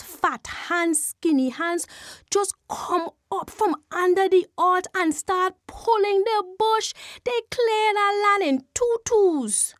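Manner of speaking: plain reading voice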